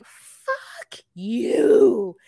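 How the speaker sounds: loud, drawn-out retort; outraged outburst. Retold or imitated. Viewer retelling reaction to Natalie's insult, outraged outburst. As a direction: explode on it, stretching the words.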